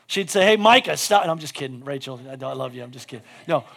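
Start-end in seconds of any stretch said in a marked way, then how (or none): 0.5-1.2 loud, raised mock scolding; imitated yell. Preacher imitates his friend scolding kids, mock yell. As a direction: call out a joking reprimand. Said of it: "would yell at the kids".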